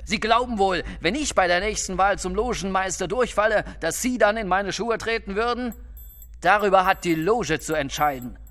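high-pitched, indignant scolding; angry rebuke. Acted. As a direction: put an upstart in his place. Boss rebukes his challenger.

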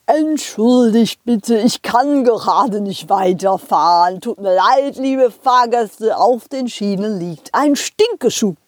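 slow apologetic driver announcement; train driver voice. Acted.

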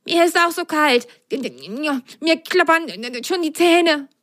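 teeth chattering, tiny cold voice; shivering. Acted. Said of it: "antwortete es zitternd". (zitternde Sockenstimme)

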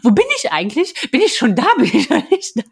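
half-laughing mock panic; panicked confusion. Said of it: "panisch aufgewacht".

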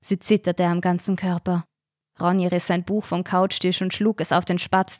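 plain reading voice

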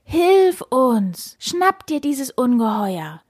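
high, urgent plea for help; pleading. A narrator's reading. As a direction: beg the knight to save you. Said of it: "flehten den Ritter an". (flehentlich, mit hoher Stimme)

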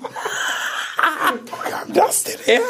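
squeaky, high, breathy laugh-through; fighting back the giggles; suppressed laughter. Real, not acted. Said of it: "Ich darf nicht lachen".

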